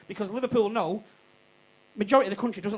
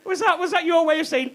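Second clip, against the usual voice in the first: ordinary talk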